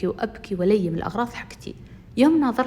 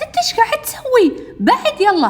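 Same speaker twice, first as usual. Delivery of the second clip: put-on very high, loud girlish protest; acted high-pitched outrage; indignant confrontation; 14 semitones higher, 7 dB louder than usual. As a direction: squawk at him to back off. Girl confronts boy snatching the photo.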